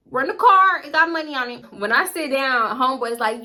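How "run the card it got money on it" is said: loud, high, fed-up call to the cashier; mock-yell it like you're done arguing; annoyed shout. Insisting to the cashier over the table's noise, loud and high. Telling the cashier to run card.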